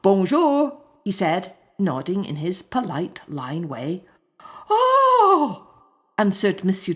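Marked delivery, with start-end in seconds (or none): 0.0-0.8 polite greeting. Acted. 4.7-5.6 high startled yelp; frightened cry. Acted. Schoolmaster faints in fright.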